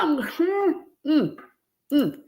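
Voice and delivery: normal talking voice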